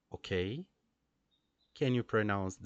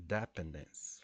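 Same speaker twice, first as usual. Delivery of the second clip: "dependence" as DEP-endence, patterned like hospital; first-syllable stress; 4 semitones lower, 4 dB quieter than usual. Shown as a demonstration. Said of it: "like hospital".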